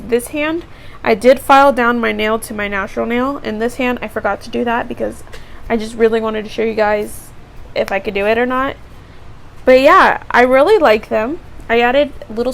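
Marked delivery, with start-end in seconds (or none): none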